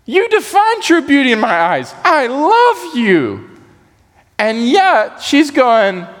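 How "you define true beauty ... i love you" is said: high, slow, swooning declaration; a hammed-up lover pouring out his heart; adoring lover. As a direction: woo her with each word. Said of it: "He's saying".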